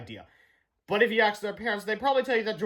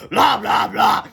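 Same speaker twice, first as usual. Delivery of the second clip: mock grumble; 4 semitones higher, 7 dB louder, much slower than usual. Mean principal voiced from comic.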